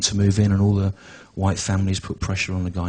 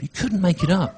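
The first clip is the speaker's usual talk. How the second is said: repeated higher with a laugh, mocking; mock catchphrase; 8 semitones higher than usual. Shown as a demonstration. Comic mocks the columnist's catchphrase, higher mock sing-song. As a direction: sneer the catchphrase back. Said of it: "the other is".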